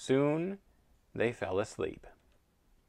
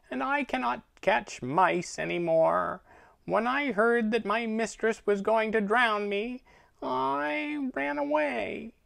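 put-on a sulky, bored old cat; snooty bored cat; 11 semitones higher than usual. Cat tells of escape.

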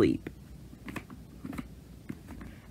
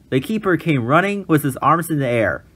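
ordinary delivery, nothing marked

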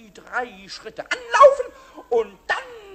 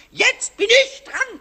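demo shout; 7 semitones higher, 5 dB louder, slower than usual. Shown as a demonstration. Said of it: "ich kann doch nicht schreien".

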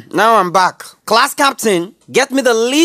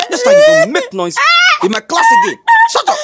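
high, fast, rising scolding; teacher losing patience, yelling; 13 semitones higher, much faster than usual. Acted. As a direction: scold the class at the top of the voice.

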